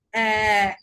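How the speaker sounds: long, held open "অ্যা"; umlaut vowel demo. Shown as a demonstration. Teacher showing students how a-umlaut sounds, drawn-out vowel. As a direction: hold "অ্যা" as one steady drawn-out vowel. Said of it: "আ umlaut-এর উচ্চারণ কিরকম হবে?".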